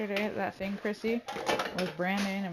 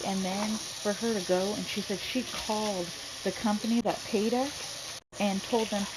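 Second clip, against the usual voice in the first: ordinary talk, nothing marked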